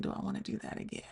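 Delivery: a soft, breathless whispered question; whispered doubt. Retold or imitated. Asking herself after it ended, a hushed whisper. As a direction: hush it like a private fear. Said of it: "it made me think".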